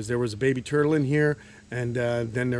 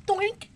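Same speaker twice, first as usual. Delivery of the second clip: cartoon doink; 4 dB quieter than usual. Sound effect looking down.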